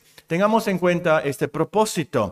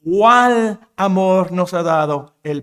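slow, louder, marveling; wonder-filled exclamation; 4 dB louder, slower than usual. Shown as a demonstration. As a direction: draw it out in amazement. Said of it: "intensifica lo maravilloso de esto".

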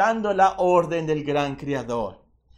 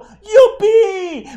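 high, loud whooping cheer; joyful shout; 16 dB louder than usual. Pretend. Preacher imagines fish happy to be caught, high gleeful shout. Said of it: "Yo me los imagino gritando".

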